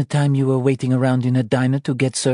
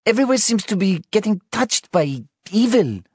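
much higher, louder frightened wife; scared crying; 14 semitones higher, 4 dB louder than usual. Acted. Wife confessing her fear of motherhood, tearful and scared. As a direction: pour out fear through tears. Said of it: "She cried".

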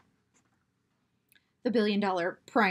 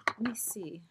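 ordinary talk, nothing marked

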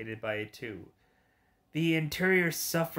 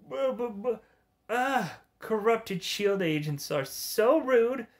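high stutter villain; 8 semitones higher than usual. Acted. Voicing game villain, stuttering start.

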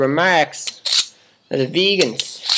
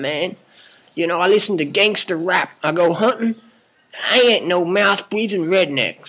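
usual speaking voice

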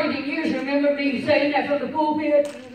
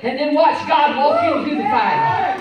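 preaching shout; 4 semitones higher, 6 dB louder than usual. Real, not acted. Preacher rising to climax.

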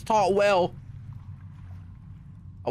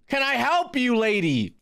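usual speaking voice

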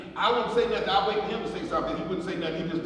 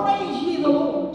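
preaching; 7 semitones higher, 5 dB louder, slower than usual. Acted. Preacher opening scripture reading.

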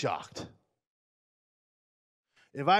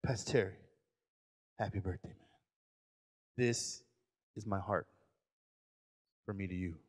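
heartfelt presentation; 7 semitones lower, 10 dB quieter than usual. Retold or imitated. Lendl giving his pastor a costly tie, softly earnest. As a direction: hand over the gift from the heart. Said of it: "He's gonna say it confidently".

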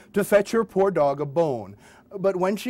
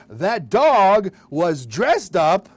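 normal talking voice

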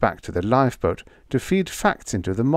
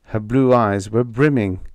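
ordinary delivery

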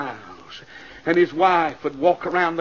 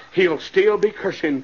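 ordinary delivery

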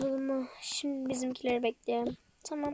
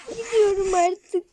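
crying; 7 semitones higher, 10 dB louder than usual. Acted. Blossom leaving in tears.

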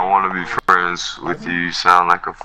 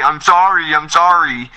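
higher, louder sneering imitation of an apology; mock the other player's sorry, sneering and higher; mocking whine; 7 semitones higher, 3 dB louder than usual. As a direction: throw his apology back at him with scorn. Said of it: "Nobody wants to hear you say".

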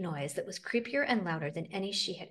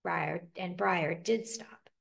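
ordinary delivery, nothing marked

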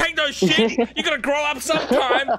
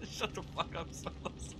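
whispered, much softer, almost no voice; hushed; 7 semitones lower, 19 dB quieter than usual. Real, not acted. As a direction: hiss it under your breath while still in pain. Hurt, laughing, hushed at friend.

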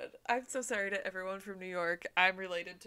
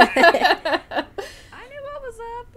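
smug; 14 dB louder, much slower than usual. Pretend. Joking brag.

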